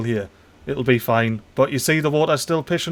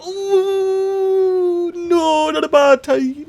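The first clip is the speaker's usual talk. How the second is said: high hammed-up wailing dismay; comic high moan of despair; mock wail; 14 semitones higher, much slower than usual. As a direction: pretend to cry over the tide. Bad tide after emptying reservoir.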